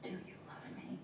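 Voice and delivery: hushed, whispered plea; mild acted sob. A narrator's reading. Drunk father pleading with his child for love, whispered half-sob. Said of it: "he began to sob".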